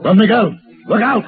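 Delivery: loud, high shout of alarm; urgent warning shout. Acted. Paladin warns Don Miguel of the attacker, urgent shout. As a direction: yell the warning across the box.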